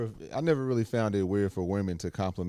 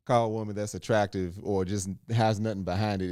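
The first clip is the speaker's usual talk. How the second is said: normal talking voice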